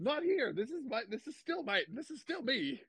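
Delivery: high-pitched awkward clarification; sheepish high voice, acted out; mock correction. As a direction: pipe up, playfully setting fans straight. Mock reply to mistaken fans.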